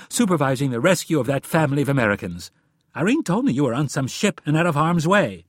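high, loud, heated rebuke; angry tirade. A narrator's reading. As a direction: pile up the accusations against him. Wife rages at husband's lies.

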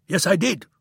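high, loud furious scream. A narrator's reading. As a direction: scream it back in defiance. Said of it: "screamed Friedman".

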